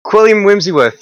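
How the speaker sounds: calling his name out loud; loud proclamation. Acted. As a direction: announce him grandly. Calling the strong man's name.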